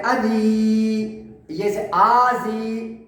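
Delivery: اذی dragged long on a level note; wrongly drawn out. Shown as a demonstration. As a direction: stretch the vowels of اذی far too long. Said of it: "کھینچ کے پڑھتے ہیں،".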